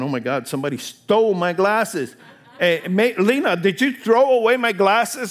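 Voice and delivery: high, loud, hammed-up alarm; a comic shout blaming Lena for the glasses; mock panic. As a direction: playfully yell for Lena about the glasses. Acted panic over lost glasses.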